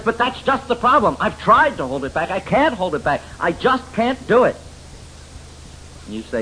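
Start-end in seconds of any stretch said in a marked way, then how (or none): 0.0-4.6 high, pleading despair; a woman insisting she can't cope; high, helpless protest; frustrated mother. Retold or imitated. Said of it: "She says".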